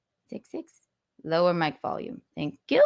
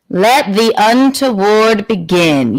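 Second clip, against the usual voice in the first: slow, louder showman proclamation; grand announcement; 3 semitones higher, 11 dB louder, slower than usual. Acted. Host opening her Tuesday show, slow and grand. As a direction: declare the show open like a ringmaster.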